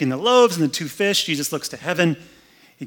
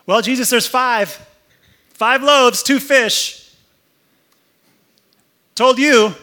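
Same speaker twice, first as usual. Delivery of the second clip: much higher, louder gloating; smug sarcastic told-you; 9 semitones higher, 9 dB louder, slower than usual. Acted. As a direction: rub it in, case closed. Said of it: "some sarcasm there".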